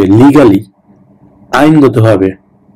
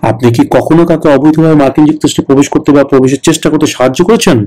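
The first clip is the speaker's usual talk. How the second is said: normal talking voice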